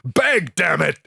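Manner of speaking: high, forceful taunting impression; hammed-up taunting command; mocking villain. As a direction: sneer the order as an imitated wrestling bad guy. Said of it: "[name] is mocking him".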